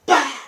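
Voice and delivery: loud, high vocal smack; explosive mouth-made impact noise; imitated strike impact. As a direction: burst out a sharp hit sound.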